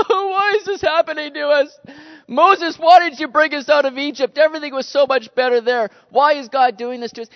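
loud, high-pitched crybaby wailing; hammed-up whine, complaining like a bunch of crybabies; mock whining. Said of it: "They whined. They cried".

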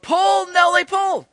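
high, loud yell to the horse; a farmer's shout across the field. Retold or imitated. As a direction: call the horse hard. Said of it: "the farmer began to yell out".

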